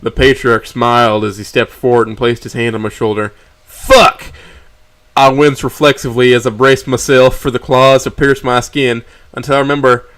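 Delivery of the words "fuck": high, loud startled curse; panicked yelp. A narrator's reading. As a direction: flinch and yelp. Flinches expecting claws.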